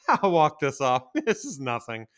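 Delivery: chuckling through the words; laughing it off. Retold or imitated. Brushing off his injured foot, laughing. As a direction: laugh off the pain. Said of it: "I was like".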